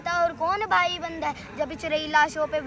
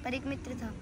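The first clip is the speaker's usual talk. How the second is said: soft verse lead-in; hushed recital; 3 semitones lower, 10 dB quieter than usual. Acted. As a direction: say it quietly, trailing down. Soft first take of verse. (धीमे, दबे स्वर में)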